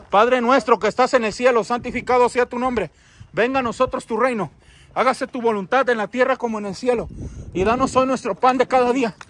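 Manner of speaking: steady, projected Padre Nuestro; recited prayer. Real, not acted. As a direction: pray aloud firmly, in measured phrases. Recites the Lord's Prayer aloud. (oración rezada en voz alta y firme)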